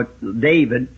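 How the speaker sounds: usual speaking voice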